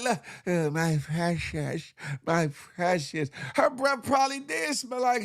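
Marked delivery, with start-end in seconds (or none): 0.5-3.3 slow, hissing creature croon over his precious; Smeagol voice. Retold or imitated. Comic mocking the teacher's teeth, slow Gollum croon. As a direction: croon it like Gollum clutching the ring. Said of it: "Looking like that Smeagol".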